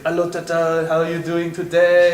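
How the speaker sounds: a friendly hint of the hero on a video call; Jean-Claude Van Damme. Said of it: "it answers as Jean-Claude Van Damme on Skype".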